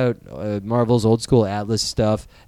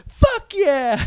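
put-on much higher excited kid cheer; little kid hearing Batman swear, high excited cheer; kid excitement. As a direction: squeal it like a thrilled little kid. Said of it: "I would be like".

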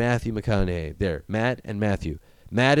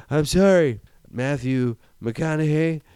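space crazy; 4 semitones higher than usual. Retold or imitated. Matt Damon abandoning McConaughey, higher unhinged rant. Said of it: "I've gone space crazy".

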